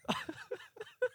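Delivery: squealing laugh; high laugh. Real, not acted. Loud laugh.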